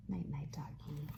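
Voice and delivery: hushed bedtime whisper. Acted. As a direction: whisper goodnight softly. Goodnight whisper.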